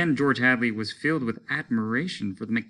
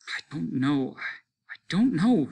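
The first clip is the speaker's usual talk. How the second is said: tearful; 6 semitones higher than usual. A narrator's reading. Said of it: "blowing her nose".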